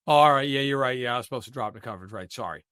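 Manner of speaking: low, hurried apology that fades out; mock contrite. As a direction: brush off the scolding with a dropping, mumbled sorry. Rookie gives in to coach's scolding.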